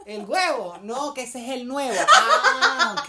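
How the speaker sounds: el huevo repetido más fuerte. Pretend. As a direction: repite fuerte porque no oye. Repite sin entender. (repetición más fuerte del huevo)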